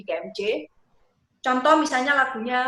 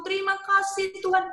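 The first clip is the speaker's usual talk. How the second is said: thanks lifted higher; higher thankful; 7 semitones higher than usual. Shown as a demonstration. Leader demos rising thanksgiving to the congregation. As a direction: lift the phrase up with thanks. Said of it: "Jadi dari syukur".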